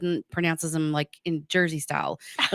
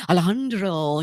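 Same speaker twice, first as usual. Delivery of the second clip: put-on Spanish accent; 5 dB louder, slower than usual. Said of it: "they're all of a sudden like".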